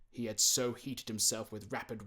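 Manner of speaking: ordinary delivery, nothing marked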